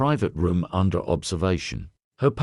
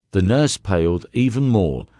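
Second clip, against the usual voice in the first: ordinary delivery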